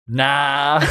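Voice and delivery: dismissive nah. Retold or imitated. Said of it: "she's basically just like".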